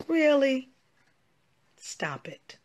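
usual speaking voice

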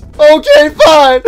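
high, loud mock-crying yell; hammed-up crybaby shout; bawling surrender. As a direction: wail it out, hurt and defeated. Sent away, bawling again.

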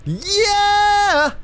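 very high, drawn-out whoop; triumphant cheer. Real, not acted. Player celebrating a move, high long cheer.

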